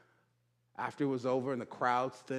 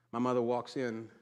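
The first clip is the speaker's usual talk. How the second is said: ordinary delivery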